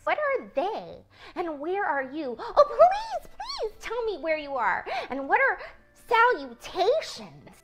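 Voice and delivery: high, pleading and urgent; excited little pig pleading; eager pleading pig. Acted. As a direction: beg to know where she is. Pig begging to find voice.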